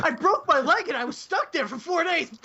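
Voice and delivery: high, laughing mock complaint; laughing mock whine. Mocking the injured friend, laughing.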